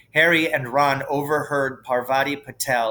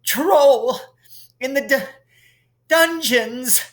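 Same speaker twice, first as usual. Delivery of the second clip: high, stammering, breathless panic; gasping terror; 12 semitones higher, 4 dB louder than usual. A narrator's reading. Quirrell warning Dumbledore of the troll, stammering in fear. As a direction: gasp out the news in terror. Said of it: "and gasped".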